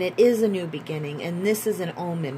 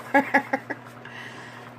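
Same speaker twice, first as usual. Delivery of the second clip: a short surprised laugh, airy and high; real amused chuckle; breathy high laugh; 7 semitones higher than usual. Real, not acted. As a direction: let a quick startled laugh escape. Laughs at flipped card.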